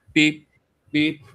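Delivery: short high mock beeps; imitated beeping. Imitating the smoke alarm beep.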